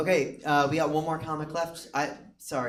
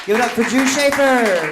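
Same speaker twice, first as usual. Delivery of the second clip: very high, full-volume shout; announcer's big intro; 12 semitones higher, 17 dB louder than usual. Real, not acted. Host bringing up the last comic, shouting. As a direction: belt it out, holding the final name.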